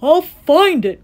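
slow, high, loud vow; crying out. A narrator's reading. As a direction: cry out with determination. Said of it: "cried Horton".

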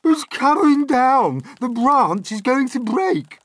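loud, high cry of alarm; panicked shout. A narrator's reading. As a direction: shout as if it's about to crash down. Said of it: "Aunt Sponge shouted".

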